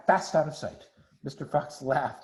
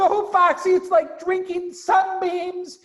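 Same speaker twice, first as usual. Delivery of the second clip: rapturous; 6 dB louder, slower than usual. Acted. Badger rhapsodising over cider.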